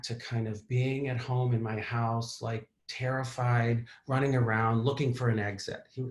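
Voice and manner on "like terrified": ordinary delivery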